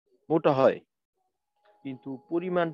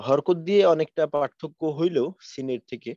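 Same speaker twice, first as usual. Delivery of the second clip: ordinary delivery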